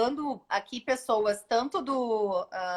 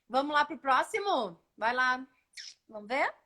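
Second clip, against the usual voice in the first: ordinary delivery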